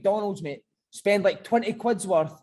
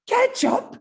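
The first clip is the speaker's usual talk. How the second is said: much higher and louder, spitting out Ketchup; disgusted rant; 8 semitones higher, 6 dB louder, slower than usual. Retold or imitated. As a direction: scorn the sauce like a furious coach. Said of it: "he's like".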